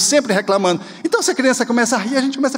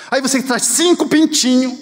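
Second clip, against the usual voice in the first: ordinary talk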